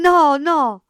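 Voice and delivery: high, loud cry of protest; desperate boy crying out; frantic shout. A narrator's reading. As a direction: shout it out in terror. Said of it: "gridò Cinto".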